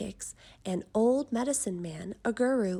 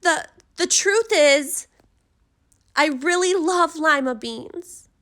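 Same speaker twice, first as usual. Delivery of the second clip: blurted confession; 7 semitones higher, 12 dB louder than usual. A narrator's reading. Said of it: "Camilla confesses desperately".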